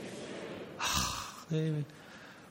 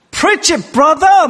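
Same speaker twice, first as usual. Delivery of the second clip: much higher, loud cry of approval; 13 semitones higher, 8 dB louder, much slower than usual. Retold or imitated. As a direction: call out like a fired-up church member. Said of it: "막 흑인 교회에서는요".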